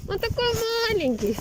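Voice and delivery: cooing. Real, not acted. Cooing over something small.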